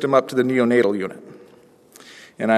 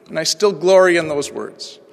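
normal talking voice

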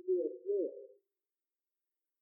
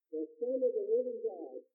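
ordinary talk

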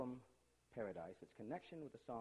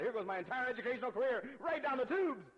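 mock despair; 11 semitones higher, 11 dB louder than usual. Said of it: "You know, I thought".

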